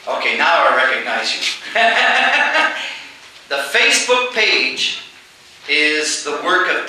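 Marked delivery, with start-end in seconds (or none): 1.5-3.0 a bright high burst of laughter. Real, not acted. Speaker laughing to Debbie in the crowd, high laugh.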